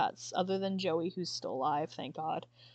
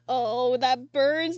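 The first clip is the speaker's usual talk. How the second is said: stinging pain; 4 semitones higher, 8 dB louder, much slower than usual. Real, not acted. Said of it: "have you ever had the tears".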